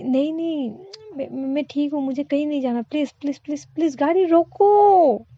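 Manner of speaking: put-on panicked girl screaming at the driver to stop; building to a shout. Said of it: "इतनी ज़ोर से चिल्लाती है".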